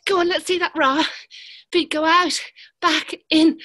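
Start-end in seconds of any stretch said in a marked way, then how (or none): none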